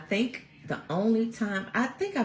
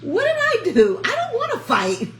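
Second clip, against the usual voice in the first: much higher, laughing, pleading voice; pleading, scared kid; 7 dB louder, slower than usual. Retold or imitated. Middle-school her begging a girl not to fight, high laughing plea. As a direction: squeak out a frightened why with a laugh. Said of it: "I'ma be like".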